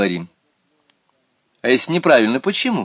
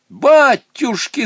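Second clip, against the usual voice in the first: high, loud, slow shriek of dismay; old woman crying out; 9 dB louder, much slower than usual. Acted. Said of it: "закричала бабушка". (громкий высокий вопль бабушки)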